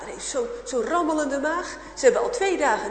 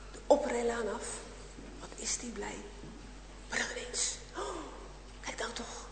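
hushed, breathless storytelling whisper; whispered narration; 9 dB quieter than usual. A narrator's reading. Whispering to the children as the truck appears. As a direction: drop to a whisper as danger nears.

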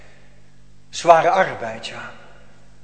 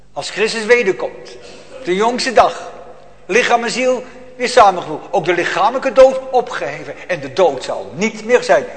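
loud, high, fervent pulpit proclamation; preaching at full force; 4 semitones higher, 8 dB louder than usual. Acted. (vurig en luid preken)

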